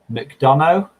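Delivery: McDonough as muk-DON-oh, rising like a question; unsure attempt at McDonough, ending on OH; tentative pronunciation. Shown as a demonstration. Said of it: "Is that how you pronounce it".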